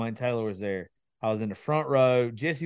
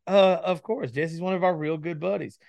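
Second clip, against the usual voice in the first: higher-pitched, louder quoted reply; imitating obvious agreement; 7 semitones higher, 3 dB louder than usual. Friend answering a request for an introduction, imitated of-course tone. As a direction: voice a friend saying it's a given.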